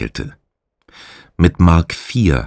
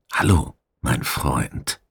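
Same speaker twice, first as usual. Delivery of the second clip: slow, soft whisper to a pet rat; 5 semitones lower, 8 dB quieter, slower than usual. A narrator's reading. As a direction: hush it, slow and tender. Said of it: "flüsterte der Gefangene".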